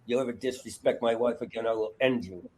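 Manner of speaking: low, soft acted menace; quiet threat. Comic acting out Robin turning on Matt, quietly menacing. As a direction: threaten under your breath, voice dropped low. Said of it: "when he turns on Matt".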